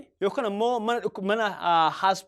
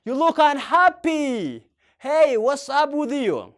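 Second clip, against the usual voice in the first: louder, slow, concerned; loud slow model; 5 semitones higher, 5 dB louder, much slower than usual. Shown as a demonstration. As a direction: ask a sad friend, loud and slow. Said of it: "Akka jettee gaafachuu dandeetta".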